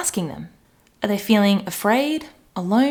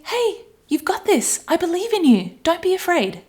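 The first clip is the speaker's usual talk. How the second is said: kind reassuring adult; 8 semitones higher than usual. Pretend. Adult comforting little me, gentle and high. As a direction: soothe a scared little one. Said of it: "You'd probably say".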